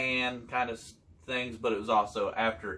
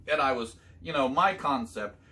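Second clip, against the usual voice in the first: ordinary talk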